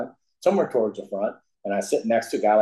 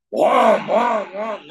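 loud, high-pitched car roar imitation; imitated engine roar; 9 semitones higher, 6 dB louder, much slower than usual. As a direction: blare it out like a car with no exhaust. Imitating the exhaust-less car's roar.

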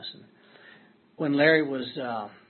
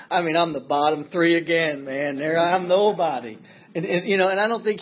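mock indignation; 5 semitones higher, 9 dB louder than usual. Real, not acted. Indignant about flyer billing.

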